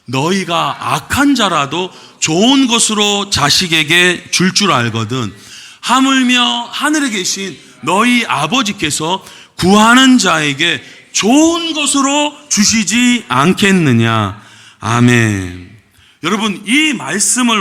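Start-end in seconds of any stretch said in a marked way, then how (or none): 0.0-14.4 slow, loud, measured recitation; scripture reading. Real, not acted. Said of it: "다시 한번 또 읽어보겠습니다". 14.8-15.8 drawn-out amen. Real, not acted. Closing scripture reading.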